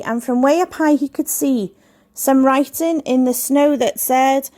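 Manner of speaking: usual speaking voice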